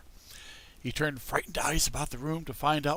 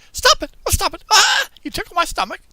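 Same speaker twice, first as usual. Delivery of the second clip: very high, loud squeal of ticklish laughter; a tiny voice squirming under the tickling plane; giggling tiny voice; 10 dB louder than usual. A narrator's reading. The wood giggling at the carpenter to stop tickling. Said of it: "giggled as it spoke".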